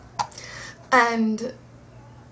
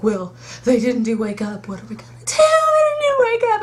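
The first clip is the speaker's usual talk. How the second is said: mock panic; 2 semitones higher, 4 dB louder than usual. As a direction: fake a meltdown over the missing song. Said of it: "and I was like".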